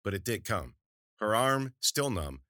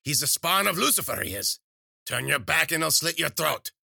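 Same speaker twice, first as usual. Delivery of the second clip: high, loud ranting crone; shouting witch; 9 semitones higher, 7 dB louder than usual. A narrator's reading. Witch shouting warnings about the cat from the kitchen, loud high. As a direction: rail against the cat from afar.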